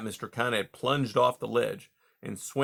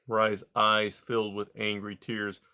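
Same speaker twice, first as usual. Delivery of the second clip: usual speaking voice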